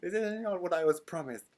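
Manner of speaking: loud, high mock outrage; hammed-up disappointed fan's tirade; ranting and raving. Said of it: "me just ranting and raving".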